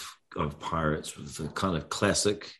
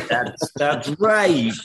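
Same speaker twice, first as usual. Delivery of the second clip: slow, drawn-out, loud hammed-up New Jersey accent; mock New Jersey accent; 8 semitones higher, 5 dB louder, much slower than usual. Joking about being from New Jersey in a hammed-up New Jersey accent. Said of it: "That's my New Jersey accent".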